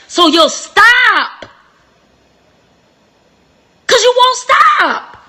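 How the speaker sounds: high, loud, slow preaching cry; a loud, slow, crying climax. Real, not acted. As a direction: drive it home like a pulpit climax.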